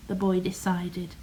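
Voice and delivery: plain reading voice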